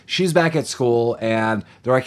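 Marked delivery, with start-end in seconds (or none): none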